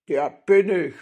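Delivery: loud, forceful bandit voice; boastful robber. A narrator's reading. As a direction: declare it loudly as the robber. Robber confirms who he is.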